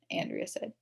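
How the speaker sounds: ordinary delivery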